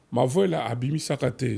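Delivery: normal talking voice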